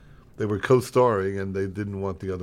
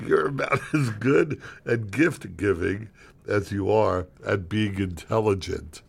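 slow deliberate retake; slower than usual. Acted. Retakes insult line composed.

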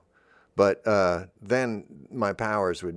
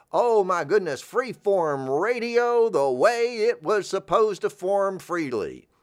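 gushing; 10 semitones higher, 5 dB louder, slower than usual. Real, not acted. Gushing about freeform radio.

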